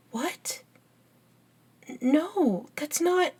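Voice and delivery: high, startled denial; taken aback. Acted. Accused friend denying the charge, taken aback. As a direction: protest in surprise, pitch jumping up.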